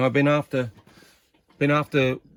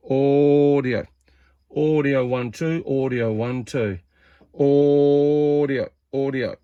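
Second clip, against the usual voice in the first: drawn-out audio check into the mic; radio test call; 3 semitones higher, much slower than usual. Real, not acted. Testing his CB transmit, drawn-out radio check call. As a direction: call it out slow like keying a CB.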